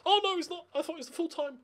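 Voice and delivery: ordinary delivery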